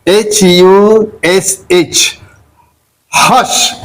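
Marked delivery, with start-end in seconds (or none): 3.1-3.7 "hush" with the short a-sound "uh" for U; teacher demonstrating "hush" with U as a short a. Shown as a demonstration. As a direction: say "hush" with U like the a in "but". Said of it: "A sound".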